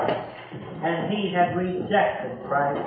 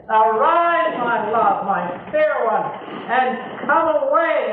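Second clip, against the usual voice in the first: tender call; 3 semitones higher, 3 dB louder than usual. Acted. The Lord calling his bride, tender and rising. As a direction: call out tenderly, then let it settle. Preacher voices the Lord's call.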